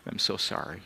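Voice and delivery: fading sobbing apology. Retold or imitated.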